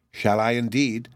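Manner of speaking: ordinary delivery, nothing marked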